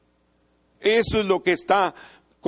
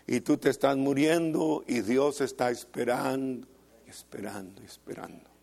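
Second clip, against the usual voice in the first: falls lower and softer into a whisper; fading; 5 semitones lower, 6 dB quieter than usual. Acted. Preacher describing God waiting while you die, fading to a whisper. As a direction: let the repeats die away to a breath.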